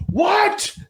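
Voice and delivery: very high, strained comic holler; mock yelled "what". Woman across a football field who can't hear him, mock holler. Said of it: "expecting her to be like".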